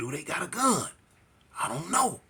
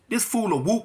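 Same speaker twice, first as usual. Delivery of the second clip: normal talking voice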